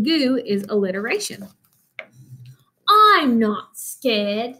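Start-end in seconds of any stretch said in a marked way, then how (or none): 2.9-4.6 put-on very slow British child bravado; British accent. Baby mummy insists to himself in the dark swamp, slow British accent. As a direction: say it slowly in a small British voice, pretending to be brave. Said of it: "read it in a British accent whenever the little mummy talks".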